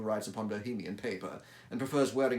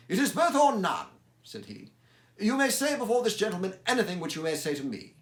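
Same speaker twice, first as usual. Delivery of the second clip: high, loud and firm; Holmes voice; 10 semitones higher, 8 dB louder than usual. Acted. Holmes insists Watson stays, loud and firm. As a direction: refuse to send your friend away.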